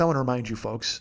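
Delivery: deadpan catchphrase delivery. Shown as a demonstration. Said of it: "No, I don't at all".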